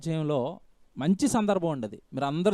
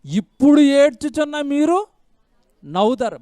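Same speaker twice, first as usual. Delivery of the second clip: higher, louder, slow proclamation; proclaimed verse; 6 semitones higher, 7 dB louder, slower than usual. Acted. Preacher proclaiming the verse loudly to the congregation. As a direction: call out the promise with force.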